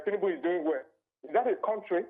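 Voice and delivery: usual speaking voice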